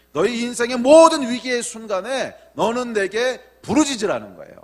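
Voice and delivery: emphatic sermon with high, loud peaks; forceful preaching. Real, not acted. Preacher tells the congregation God's command in crisis, forceful and emphatic. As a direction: drive the message home to the congregation.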